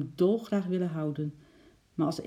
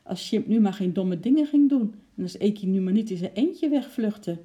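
raised, louder fretful telling; worried; 4 semitones higher, 6 dB louder than usual. A narrator's reading. Lotje fearing the squirrel will flee, worried. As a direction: fret aloud, voice lifted. Lotje fretting about the runaways.

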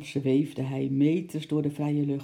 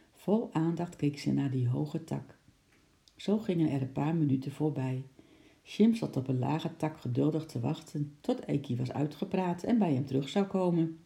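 plain reading voice

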